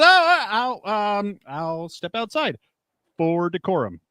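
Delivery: high, stammering embarrassed man backing off; flustered technician. Retold or imitated. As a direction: play the awkward technician excusing himself. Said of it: "And he goes".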